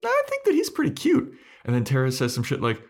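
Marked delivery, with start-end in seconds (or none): 0.0-1.3 very high, fast squeaky demon voice; mock Clem.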